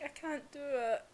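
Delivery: subdued retold admission; Inosuke faltering. Inosuke unable to strike his best mate, subdued. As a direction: imitate quietly giving up.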